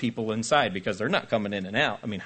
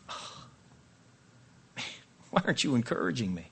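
whining aside; 2 semitones lower, 5 dB quieter than usual. Retold or imitated. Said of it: "And it's like".

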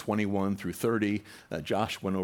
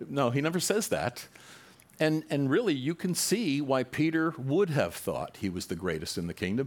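normal talking voice

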